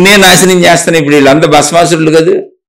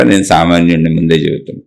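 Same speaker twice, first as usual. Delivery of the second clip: much softer and lower, modest admission; humble aside; 10 semitones lower, 5 dB quieter than usual. Real, not acted. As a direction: drop the voice to confess being ordinary. Humble aside about himself. (మెల్లగా వినయంగా)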